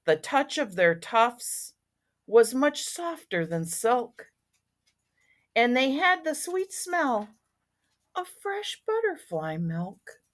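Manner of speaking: lingering, expressive storybook reading; story narration. A narrator's reading. Once-ler recalling the trees he found, expressive storybook narration. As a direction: read aloud, drawing out key words.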